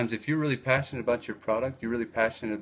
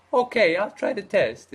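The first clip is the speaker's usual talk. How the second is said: higher, louder put-on Italian voice; the Italian guy eagerly accepting; 9 semitones higher, 6 dB louder than usual. Italian guy agreeing to the store owner's test, high put-on voice. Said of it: "And he says".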